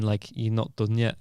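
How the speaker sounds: normal talking voice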